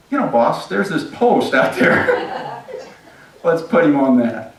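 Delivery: slow, high sly suggestion with a laugh; helpful eunuch. Acted. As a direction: offer it up slyly, chuckling. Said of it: "this helpful eunuch says".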